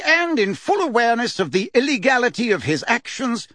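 pompous official droning through a legal indictment; Fudge reading charges. A narrator's reading. Fudge reads the formal charges.

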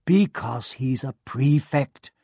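proud and fond. A narrator's reading. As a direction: say it fondly, slowly. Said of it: "said their mother fondly".

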